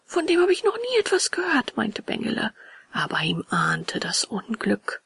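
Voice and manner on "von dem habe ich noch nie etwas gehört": high-pitched naive puppet; puzzled little Hampelmann. Acted. Puppet Bengele voiced in the story. (hohe, verwunderte Puppenstimme)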